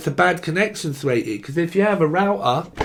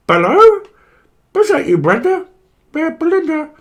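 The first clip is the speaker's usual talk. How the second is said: very high, loud silly voice; mock phone caller; 13 semitones higher, 8 dB louder than usual. Pretending to answer the home phone, high silly caller. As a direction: squeal down a dodgy phone line. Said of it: "I'm gonna pick up the home phone".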